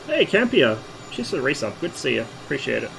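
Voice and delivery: ordinary talk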